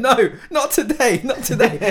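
high, drawn-out defiance through laughter; amused mimicry. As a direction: mimic a cheeky escapee, laughing. Voicing fleeing man, amused.

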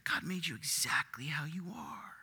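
whispered, hushed and breathless; sly whispered lie. Shown as a demonstration. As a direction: breathe the line like a seductive secret. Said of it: "I'll say it again".

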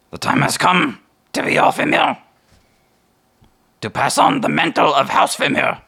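high, loud, solemn bird voice; raven decree. Acted. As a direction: announce the prophecy like a raven herald. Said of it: "and says".

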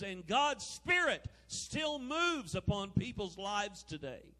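high, loud fiery preaching; preaching at full force. Acted. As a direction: lift the crowd with rising fervor.

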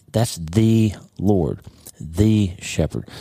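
"the" drawn out and stressed, very slow; slow it right down and lean on "the"; weighty, deliberate "that's the Lord, the shepherd"; slow emphasis. Shown as a demonstration. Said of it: "But when it comes to Christ".

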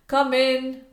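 high, carrying shout. A narrator's reading. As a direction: call out to whoever is knocking. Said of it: "Mister Clayton's shouts".

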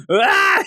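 high-pitched mock scream. Boy screams at what he said, high mock scream. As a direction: imitate his horrified scream. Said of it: "He screams".

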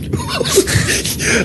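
high squealing unvoiced laugh. Real, not acted. Player cracking up at his own abduction idea, squealing laugh.

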